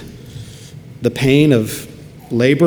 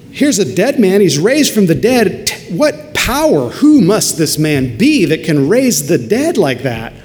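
stunned awe; 6 semitones higher, 4 dB louder than usual. Acted. Awe at the raised dead.

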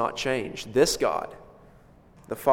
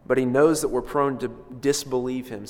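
ordinary delivery

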